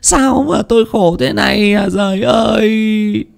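high, slow keening wail; wailing lament. Acted. Widow wails over her dead husband and drugged son, slow and high. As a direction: mourn aloud as if your heart is breaking. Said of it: "bà lại khóc lóc".

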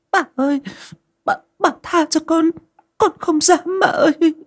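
very high sobbing begging voice; crying plea. Acted. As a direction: sob and beg the mistress. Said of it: "vừa khóc lóc vừa van xin". (vừa khóc vừa van xin)

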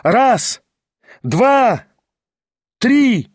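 high, drawn-out duel count; shouted count. A narrator's reading. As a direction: call out each number on its own, climbing in pitch. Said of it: "изо всей силы вскричал Кириллов". (выкрикнутый счёт)

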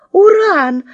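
calling the puppy high and loud; excited shout. Acted. Girls shout to the puppy, a joyful call. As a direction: call out the dog's name with joy. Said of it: "закричали мы в один голос".

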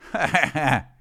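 high, acted ha-ha-ha; mock laugh as if tickling yourself; staged high laughter; tickled laugh. A narrator's reading. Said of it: "пощекотал себя У Ф".

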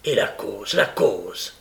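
low-pitched, pointed question; triumphant prompting. A narrator's reading. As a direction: press for the cause in triumph. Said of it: "d'un air triomphant".

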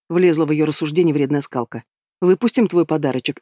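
ordinary delivery

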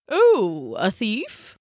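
drawn-out swoop into a question; puzzled elder penguin, falling then rising; Poppo. A narrator's reading. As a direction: ponder it with a swooping oh. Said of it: "the elder male penguin".

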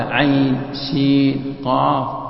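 عين، سين، قاف each drawn out long; slow, sustained letters عين، سين، قاف; six-beat madd. Shown as a demonstration. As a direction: hold the middle of عين، سين، قاف evenly. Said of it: "كلها تمد ست حركات".

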